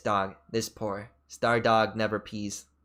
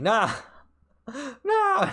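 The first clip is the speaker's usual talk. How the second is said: mock scolding no; 11 semitones higher than usual. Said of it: "It's like".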